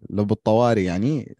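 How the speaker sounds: ordinary talk, nothing marked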